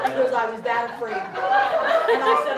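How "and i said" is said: 1 laughing. Real, not acted. Laughing through the story.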